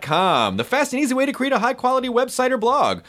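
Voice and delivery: upbeat sponsor read. Acted. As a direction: read the ad brightly. Reads sponsor ad.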